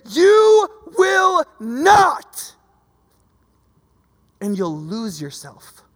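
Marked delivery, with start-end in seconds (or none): 0.1-2.5 much higher and louder, very slow commanding voice; a booming, drawn-out divine command; voice of God. Acted. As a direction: thunder it out slowly as a God as big as the universe. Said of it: "God the size of the canopy of the universe saying".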